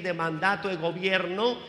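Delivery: ordinary talk, nothing marked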